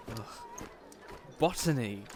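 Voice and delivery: usual speaking voice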